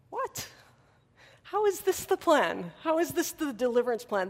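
a sudden high startled "What?", then puzzled questions; baffled disbelief. Pretend. Pastor voicing the crowd hearing the Beatitudes, stunned confusion. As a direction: voice the crowd's confusion, pitch leaping up.

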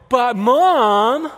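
high, loud whiny outburst; exploding kid. Acted. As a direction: blow up at mom like a frustrated kid. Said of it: "you can totally explode".

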